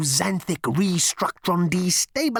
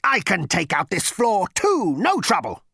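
boastful rampage; 11 semitones higher, 7 dB louder than usual. Acted. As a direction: boast at full blast, stretching it out. Raging machine boasts before firing.

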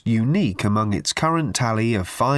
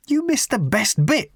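high-pitched indignant outburst; raging; 11 semitones higher than usual. A narrator's reading. Ford scolds Arthur for missing his story, raging. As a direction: rage at him in a raised pitch. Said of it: "raged Ford".

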